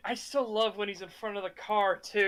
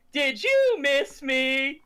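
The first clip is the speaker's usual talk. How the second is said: slow teasing quote; 4 semitones higher, 5 dB louder, much slower than usual. Retold or imitated. Quoting movie line.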